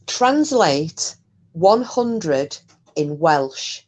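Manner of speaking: slow, deliberate voice command; talking slowly to a device; slow clear command. Real, not acted. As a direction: spell it out slowly for the smart speaker.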